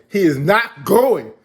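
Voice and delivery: he is not going, hammered out; firm loud refusal. Retold or imitated. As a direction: lay down the law. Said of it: "Alpha was like".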